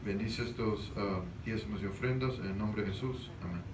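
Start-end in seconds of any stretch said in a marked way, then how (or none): none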